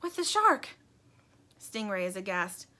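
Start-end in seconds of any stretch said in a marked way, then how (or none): 0.0-0.7 high, startled little voice; jolted into a question; high startled toy voice. Acted. Plastic startled by the news.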